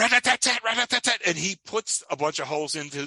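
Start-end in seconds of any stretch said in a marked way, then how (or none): 0.0-1.2 high, rapid-fire rifle noise; vocal rat-a-tat burst, pitched up; imitated gunfire. Imitates rifle firing in retold scene.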